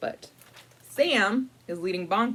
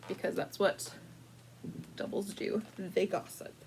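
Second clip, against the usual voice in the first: conspiratorial whisper; 10 dB quieter than usual. Acted. Gossiping doubles aside.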